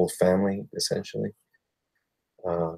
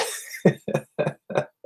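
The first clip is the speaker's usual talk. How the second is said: odd laugh. Real, not acted. Laughing at own joke.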